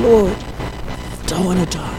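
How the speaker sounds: faint dying plea. Retold or imitated. Drowning man praying to God, faint slow plea. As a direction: breathe the plea out faintly, like he is barely alive. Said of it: "and he kept saying".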